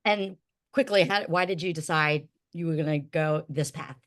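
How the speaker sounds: ordinary delivery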